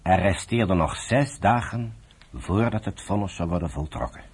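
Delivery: ordinary talk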